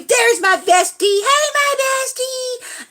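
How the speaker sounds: high, loud cry rising into a held singsong; excited greeting. Real, not acted. Streamer spots her bestie in chat, excited high singsong.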